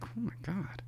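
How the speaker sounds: breathless hushed whisper; whispered oh my god. Retold or imitated. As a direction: whisper it under your breath in disbelief. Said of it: "I'm like".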